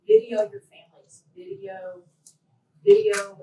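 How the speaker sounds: loud high call of video, video; urging loudly. Real, not acted. Speaker urging the audience to record family, loud emphatic call. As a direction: drive the point home to the crowd. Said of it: "urging the audience".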